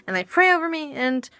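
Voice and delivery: ordinary talk, nothing marked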